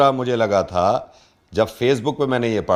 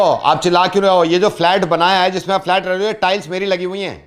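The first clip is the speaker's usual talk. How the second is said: raised-pitch, indignant prank act; protesting builder; 5 semitones higher, 3 dB louder than usual. Pretend. Builder objects to owner's shouting, mock indignant. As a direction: play a builder affronted at being yelled at.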